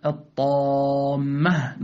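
"الطامة" with a full, dark emphatic ط and long heavy aa; heavy tafkhim. Shown as a demonstration. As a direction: stretch the heavy alif in "الطامة". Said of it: "نفخمها". (تفخيم الألف في "الطامة")

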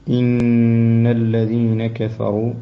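إن with the doubled nun held nasal and slow; nasal ghunna. Shown as a demonstration. Teacher showing the nasal nun to the student. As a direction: hum the nun of إن. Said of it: "نون مشددة أيضًا فيها الغنة".

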